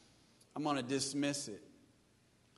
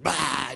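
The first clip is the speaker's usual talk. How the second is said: mock burst of anger; 2 semitones lower, 9 dB louder than usual. Imitating spewing anger.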